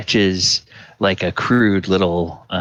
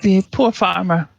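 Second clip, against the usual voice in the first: much higher, chatty country wife; farm wife voice; 12 semitones higher than usual. Acted. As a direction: prattle sweetly like a farm wife.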